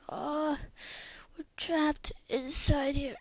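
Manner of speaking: slow, high-pitched cry; acted distress. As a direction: play people wailing from inside a trap. Trapped people call for help.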